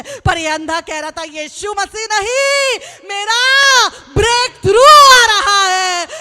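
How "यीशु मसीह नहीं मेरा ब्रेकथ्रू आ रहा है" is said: slow, higher, louder crying shout; desperate shout. Acted. Blind beggar crying out as Jesus passes, desperate shout. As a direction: cry out as if your chance is passing. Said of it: "ये जोर जोर से चिल्लाने".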